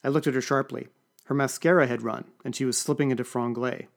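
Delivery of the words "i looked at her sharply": usual speaking voice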